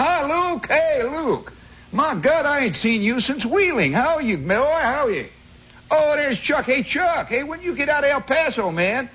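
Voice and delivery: put-on high-pitched good-old-boy hailing old pals; hammed-up high holler of hello; hollered greetings. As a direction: imitate men calling out across a crowd. Acted cockfight regulars greeting each other.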